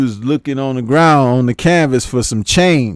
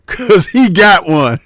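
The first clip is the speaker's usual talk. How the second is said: higher, louder, slow words after a laugh; 7 semitones higher, 5 dB louder, slower than usual. Real, not acted. Host hyping a boxer's dangerous one-two, laughing. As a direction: burst out laughing and stretch the words.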